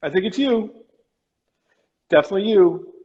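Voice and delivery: thin, distant voice over a failing video link; breaking up on a call. Real, not acted. Host on a glitchy call to his guest, voice cutting out. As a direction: sound like the line is dropping out. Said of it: "you're cutting out a bit".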